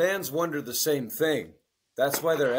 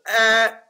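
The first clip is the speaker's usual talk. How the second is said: loud startled yelp, high and louder; mock startled yelp; 7 semitones higher, 9 dB louder than usual. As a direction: blurt out a shocked ah. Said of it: "And I was like".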